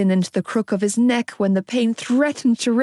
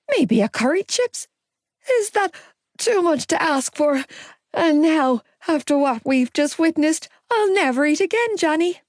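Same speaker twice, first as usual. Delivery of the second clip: high, whining distressed lament; whiny lament; 7 semitones higher than usual. A narrator's reading. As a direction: whine it like a queasy drama queen. Gibbsy rambling in distress.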